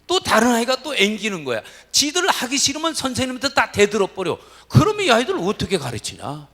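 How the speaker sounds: loud, high, heated sermon voice; preaching at full force. Acted. Preacher to congregation about unruly pupils, loud and fervent. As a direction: rail at the congregation about defiant kids.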